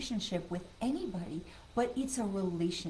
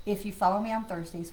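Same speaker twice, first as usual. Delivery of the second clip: normal talking voice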